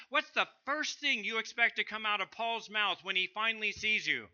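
usual speaking voice

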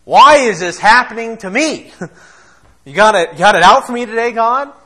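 hammed-up grumbling at heaven; complaining believer's mock outburst, high and loud; mock complaint to God. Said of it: "indictment against the character of God".

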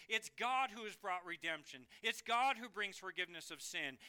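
usual speaking voice

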